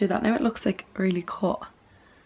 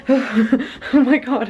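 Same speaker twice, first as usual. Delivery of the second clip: puffing after running upstairs; winded, with a breathy laugh at the start; out of breath; 5 semitones higher, 3 dB louder, much slower than usual. Real, not acted. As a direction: catch your breath as you talk. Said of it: "I'm, like, out of breath".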